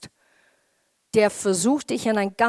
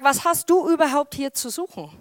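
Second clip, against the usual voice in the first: put-on higher, louder scolding older brother; mildly acted arrogance; 5 semitones higher, 5 dB louder than usual. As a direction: lightly act out a brother's indignant reproach. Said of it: "ich finde es eigentlich so arrogant". (leicht gespielte arrogante Zurechtweisung)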